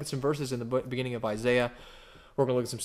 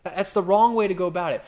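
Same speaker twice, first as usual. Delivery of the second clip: emphatic warning; 5 semitones higher, 4 dB louder than usual. Acted. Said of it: "He's like".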